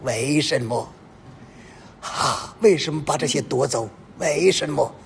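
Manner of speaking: half-whispered, imitated weeping plea; a touch of mock sobbing to God; mock tearful prayer. A believer who lost money cries to God, mock tearful. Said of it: "你在天主面前痛哭流泪".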